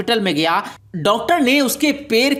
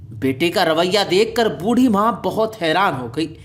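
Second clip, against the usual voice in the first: ordinary delivery, nothing marked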